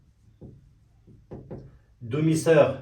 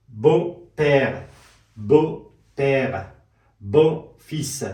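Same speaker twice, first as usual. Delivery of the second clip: slow, louder French words with a pause after each; slow drill; 5 dB louder, much slower than usual. Shown as a demonstration. Teacher reviewing French family words slowly and clearly. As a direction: say بو پیر and بو فیس slowly, one word at a time.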